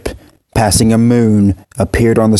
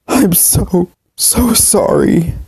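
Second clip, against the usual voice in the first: much higher, very slow breaking sob; 9 semitones higher, much slower than usual. Acted. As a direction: let the voice crack apart on sorry. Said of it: "continued to sob".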